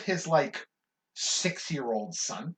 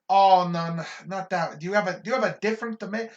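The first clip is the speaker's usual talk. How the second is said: fast, raised, fussy objection; louder, rushed customer complaint; picky customer; 5 semitones higher, 7 dB louder, faster than usual. Retold or imitated. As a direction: reject it like an impatient regular. Customer rejecting the tomato.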